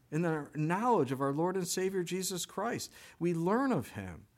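usual speaking voice

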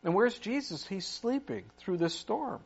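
ordinary delivery

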